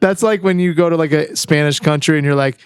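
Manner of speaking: ordinary delivery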